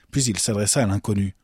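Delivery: usual speaking voice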